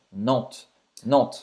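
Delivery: "Nantes" said as "nant", final E and S silent; French pronunciation. Shown as a demonstration. Teaching how to say "Nantes", quiet and careful. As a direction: stop "Nantes" on the T, nothing after. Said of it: "você não vai pronunciar esse S no final".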